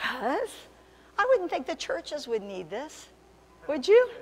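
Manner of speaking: high, slow voice of feigned innocence; mock puzzlement. Preacher teasing the congregation about churches, high mock puzzlement. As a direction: draw it out high, ending on a squeaky question.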